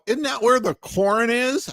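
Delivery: high condescending fan voice; high snide question; mocking Boston fan. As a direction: mimic a smug Boston fan. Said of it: "And they go".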